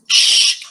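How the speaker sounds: "sh" held as a long hush, no vowel; elongated sh. Shown as a demonstration. Teacher showing learners what to elongate, long hissed "sh". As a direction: stretch "sh" out without any vowel. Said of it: "You have to elongate this".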